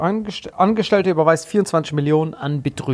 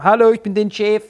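put-on boss voice, raised and loud; deepfake boss voice; 6 semitones higher, 5 dB louder than usual. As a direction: greet like a fake boss. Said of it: "Die so".

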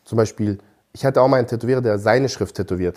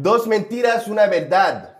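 high-pitched hyped-up game call; playful shouting; 9 semitones higher, slower than usual. Pretend. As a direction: yell out the game's name in Spanish. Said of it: "Du schrei mal nicht jetzt".

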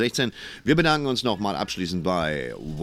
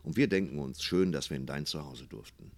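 soft, lowered storytelling voice; gentle fireside murmur, fading lower and softer; cosy bedtime sign-off; 4 semitones lower, 7 dB quieter than usual. Acted. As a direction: lull the listener towards good night.

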